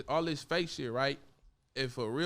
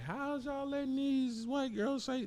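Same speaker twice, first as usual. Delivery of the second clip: high, slow imitation of a complainer; mock incredulous outsider; 10 semitones higher, slower than usual. Rapper mimics outsiders questioning locals, high incredulous voice. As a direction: draw the question out in high-pitched disbelief.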